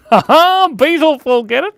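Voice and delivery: high, loud burst of laughter at the pun; laughing loud. Real, not acted. Streamer laughing at viewer's pun, loud high burst. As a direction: crack up at the pun.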